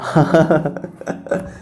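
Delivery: short real laugh at his own joke. Real, not acted. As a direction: chuckle after the pun. Laughs after his song joke. (अपने मज़ाक पर हँसी)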